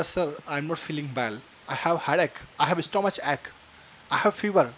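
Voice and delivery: soft, slightly low reply; mock unwell pupil. Unwell pupil answering the teacher, softly acted. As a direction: quietly play a poorly child answering.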